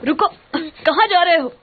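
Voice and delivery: high tearful call. Acted. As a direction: cry out after him to stop. Calling after someone leaving.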